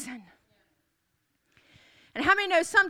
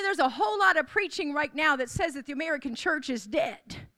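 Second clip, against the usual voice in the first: ordinary talk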